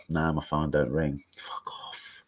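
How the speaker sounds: breathy whispered grumble to himself. Real, not acted. Streamer whispering to himself that nobody calls him. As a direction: mutter it under your breath, half to himself.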